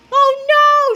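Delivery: high, loud cry of alarm; panicked shout. A narrator's reading. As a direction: shout it like a cartoon kid in danger. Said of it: "shouted Cheeseball".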